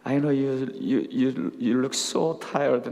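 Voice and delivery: very slow, soft and sympathetic. Retold or imitated. Pastor gently sympathizes with the exhausted consul, slow and soft. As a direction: draw it out gently, like comforting a tired friend.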